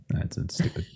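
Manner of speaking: ordinary talk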